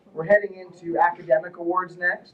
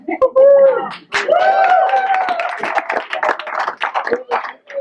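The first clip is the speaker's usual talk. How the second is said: long, loud laugh, far higher; 5 dB louder than usual. Real, not acted. Coach teasing Jason about his grades, long loud laugh. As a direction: let a long high laugh roll.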